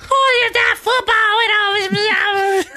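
high, slow Southern drawl imitating the lady; hammed-up Southern belle, high and stretched; mock Southern lady voice, stretched out. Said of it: "this Southern lady".

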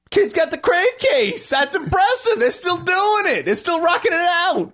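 high-pitched, delighted admiration; excited mock admiration; impressed excitement. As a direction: cheer him on as if it's a feat. Retells admiring kid with crave case.

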